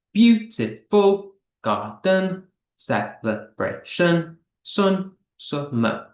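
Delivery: "Beautiful garden. Celebration. Sun. Summer." slow, with gaps between syllables. Shown as a demonstration. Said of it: "how many syllables do these words have".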